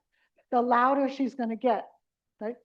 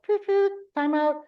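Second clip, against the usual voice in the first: higher, louder, chanted like a referee's whistle; whistle blow imitation; 6 semitones higher, 4 dB louder than usual. To a room of caregivers, a whistle-blow timeout call. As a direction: toot it out like a whistle. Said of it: "I'm gonna blow my whistle".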